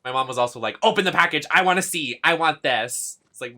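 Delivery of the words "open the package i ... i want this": loud, raised-pitch mock pleading; imitated eager mom. Streamer imitating mom demanding to see the package, eager. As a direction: playfully mimic an excited mother's demands.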